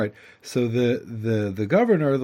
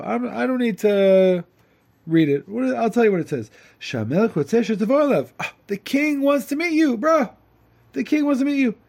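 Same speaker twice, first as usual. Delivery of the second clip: much higher, loud, bubbly messenger; excited messenger; 8 semitones higher, 4 dB louder than usual. Acted. Messenger tells the simpleton the king wants him, excited and high. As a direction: burst out the news like a buddy.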